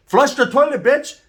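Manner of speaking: high, loud yell at cellmate; angry yell. Retold or imitated. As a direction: bark it at the cellmate. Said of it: "He's like / I'm yelling".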